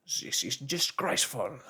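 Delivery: loud, furious harpooner bursting out; angry outburst. A narrator's reading. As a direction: explode with indignation. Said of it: "cried Ned Land, bursting out".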